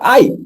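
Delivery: sharp high yelp of pain; a loud pained ay; cry of pain. Shown as a demonstration. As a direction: cry out as if hurt. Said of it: "uno grita cuando lo dice, ¡ay!".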